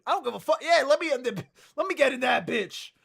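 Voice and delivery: loud, high mock band reaction; eager, defiant outburst. Band jumping at a controversial tour, eager mock shout. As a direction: shout it without hesitation, imitating a gung-ho band. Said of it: "without hesitation".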